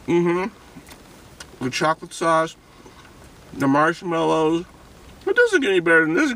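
usual speaking voice

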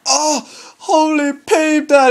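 high, slow outburst; shocked exclamation. Real, not acted. Near goal conceded.

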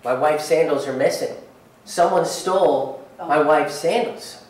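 usual speaking voice

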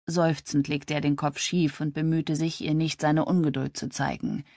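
ordinary delivery

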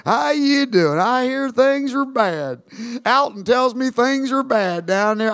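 slow, easy Texan drawl; lightly imitated Texan. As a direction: talk like a big old Texan. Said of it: "He's just a big old Texan".

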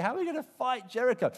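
high, loud frightened cry; terrified. Retold or imitated. Joshua before Jericho, high loud frightened cry. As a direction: panic about the coming battle. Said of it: "Joshua is terrified. He said".

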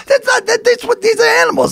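imitating wife, high and fast; wife's voice. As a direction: voice her, high and quick. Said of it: "And she goes".

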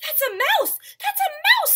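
loud, high alarm; panicked storybook exclamation; frightened cry. Acted. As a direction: yell out in panic at a mouse. Elephant terrified of a mouse.

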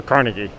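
Carnegie stressed up front, "CAR-nuh-gie"; first syllable of Carnegie stressed, falling at the end; good old American way. Shown as a demonstration. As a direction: say Carnegie the American way, KAR-nih-ghee. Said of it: "the good old American, uh, fashioned way".

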